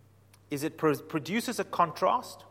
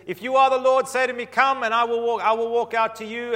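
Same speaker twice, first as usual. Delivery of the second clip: Peter calling out; 7 semitones higher, 7 dB louder than usual. Acted. Peter calls to Jesus on water.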